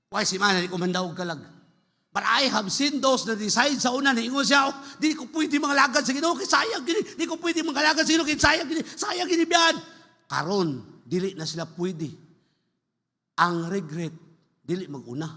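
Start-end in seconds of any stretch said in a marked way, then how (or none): 4.7-9.7 lightly hammed-up excuse, pitched higher; mock lament. Preacher imitates one who won't serve, high mock lament. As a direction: imitate someone bemoaning the waste. Voices someone's regretful excuse for not serving.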